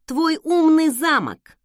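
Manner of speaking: loud, slow, pointed retort; drawn-out, annoyed emphasis; through gritted teeth. A narrator's reading. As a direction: squeeze each word out in irritation. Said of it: "процедила я".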